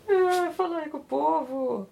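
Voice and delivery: high, slow pet-talk voice; high, slow voice coaxing her cat to greet everyone. Real, not acted. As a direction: coax the cat sweetly.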